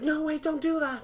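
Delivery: high, pleading villain voice; hammed-up panicking villain; panicked Voldemort. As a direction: beg in a squeaky panic. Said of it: "he's like".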